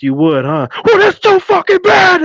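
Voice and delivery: high, loud, hammed-up sergeant outburst; imitated drill sergeant screaming at the girl; mock sergeant yell. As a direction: bark it like a drill sergeant turning nasty. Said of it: "He's like".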